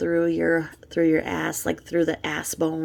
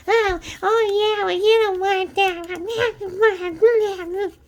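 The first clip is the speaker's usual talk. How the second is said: much higher, slow, whiny put-on dog voice; 14 semitones higher, 3 dB louder, much slower than usual. Imitating Jules voicing her dog, high whiny dog voice. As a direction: whimper it like a talking puppy. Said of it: "when she acts like the dog voice".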